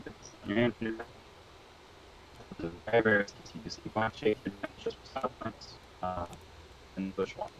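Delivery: glitchy robotic connection. Real, not acted. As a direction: voice breaking up like a robot. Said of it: "apologies for D's robotic voice".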